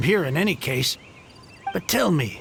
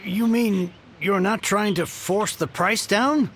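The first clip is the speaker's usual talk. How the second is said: normal talking voice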